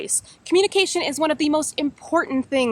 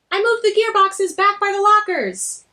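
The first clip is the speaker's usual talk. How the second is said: character voice; 9 semitones higher, 5 dB louder than usual. Acted. Character voice in story.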